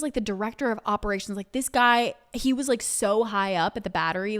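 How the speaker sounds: usual speaking voice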